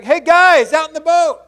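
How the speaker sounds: high, loud call across the water; Jesus calling from the shore to the disciples in the boat. Retold or imitated. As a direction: shout out to the guys in the boat. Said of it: "he calls out to him".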